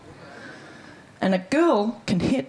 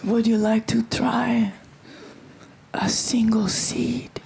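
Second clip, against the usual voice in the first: hushed mystic offer; 3 semitones higher, slower than usual. Retold or imitated. Fruit man offers a seed.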